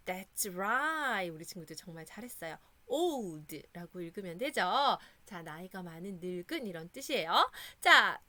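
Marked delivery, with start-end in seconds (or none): none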